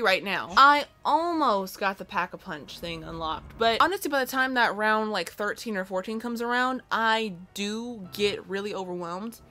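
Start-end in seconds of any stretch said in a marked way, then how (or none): none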